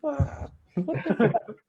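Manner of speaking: a high, laughing gasp of a swear; stunned swear. Retold or imitated. Player reliving the starting call, high stunned laughing swear. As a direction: let the shock pop out in a laugh.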